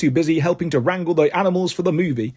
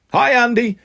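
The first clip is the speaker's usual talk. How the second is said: higher, called-out greeting; mild yell; 6 semitones higher than usual. A narrator's reading. Crowd of Andys greeting Andy, mildly yelled. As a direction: call out a hello, lightly raised. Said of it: "yell a bunch of Andys".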